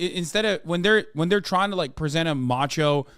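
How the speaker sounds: ordinary talk, nothing marked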